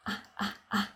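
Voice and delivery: put-on soft, short "ah, ah, ah", like a baby babbling; three hushed little baby "ah" sounds; baby-like vocalized R. Shown as a demonstration. Coach imitating baby sounds for the vocalized R, soft and baby-like. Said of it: "das klingt ein bisschen wie ein Baby".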